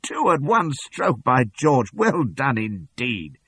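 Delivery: high, loud and slow triumphant cheering; gleeful praise. A narrator's reading. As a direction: crow over the kill, delighted. Continues the gleeful shout.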